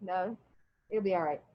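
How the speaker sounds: normal talking voice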